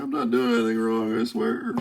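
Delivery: high, squeaky pleading pet voice; dog voice. Pretend. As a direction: beg innocently like a guilty dog. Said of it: "That's my dog voice".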